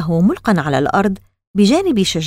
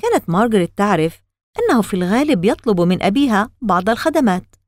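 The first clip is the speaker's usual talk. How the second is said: ordinary delivery, nothing marked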